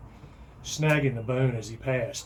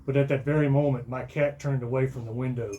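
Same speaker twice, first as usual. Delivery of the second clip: ordinary delivery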